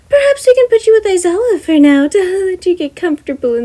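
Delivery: high, bright-voiced principal; Nezu voice. Nezu asking Gem about housing, high character voice. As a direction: lift the pitch way up for the chimera principal.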